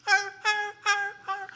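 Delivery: high, loud whiny dog yelps; imitated whining dog. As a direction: yelp like a whining young dog. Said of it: "it just whines".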